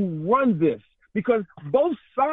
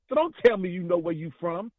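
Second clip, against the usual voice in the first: ordinary talk, nothing marked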